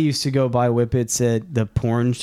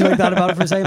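normal talking voice

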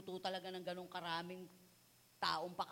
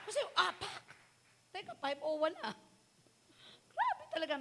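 normal talking voice

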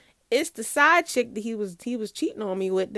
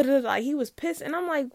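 ordinary delivery

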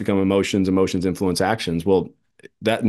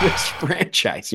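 mock coach; 6 semitones higher, much slower than usual. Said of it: "I used to joke where it's just like".

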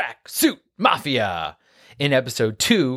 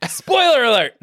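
shouted catchphrase; 11 semitones higher, 5 dB louder than usual. Acted.

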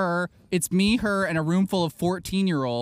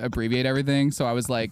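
normal talking voice

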